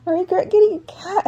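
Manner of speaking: high, put-on crying voice; imitated crying. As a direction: imitate an upset brother wailing regret. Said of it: "he's, like, crying".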